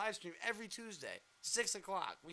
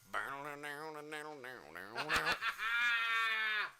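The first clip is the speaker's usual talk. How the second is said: shouted laugh; 2 semitones lower than usual. Real, not acted.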